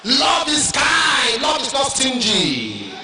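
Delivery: preaching. Acted. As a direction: shout it out slowly, word by word. Preaching at full force.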